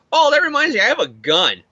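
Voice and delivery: hammed-up high female voice, dropping at the end; Mary Jane. Wife remembers she is armed, mock-bright realisation. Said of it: "And then Mary Jane's like".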